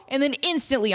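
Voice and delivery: ordinary delivery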